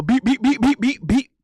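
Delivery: rapid-fire rising "beat" jabs; playful boxing sound effects; mimicked punch noises. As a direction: punch out each "beat" sharply. Imitates little boxing punches.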